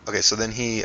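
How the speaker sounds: usual speaking voice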